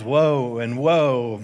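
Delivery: slow, stretched lament; drawn-out woe. Shown as a demonstration. Preacher stretches the woes for the congregation in lament. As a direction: let each woe hang mournfully. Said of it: "these woes are just laying on".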